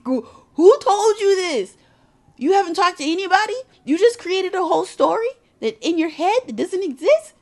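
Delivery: high, loud, disbelieving challenge; fast, exasperated mock telling-off; incredulous scolding.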